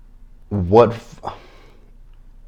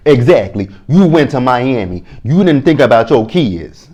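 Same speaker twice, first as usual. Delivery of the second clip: raised scolding voice; 5 semitones higher, 6 dB louder than usual. Retold or imitated. Voicing Sarah scolding Michael.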